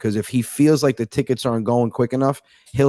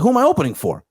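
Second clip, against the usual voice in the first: much higher-pitched retold question; repeated question; 11 semitones higher, faster than usual. Comic repeating himself to the club owner, high-pitched questioning. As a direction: repeat it with the pitch jumping up on "opening".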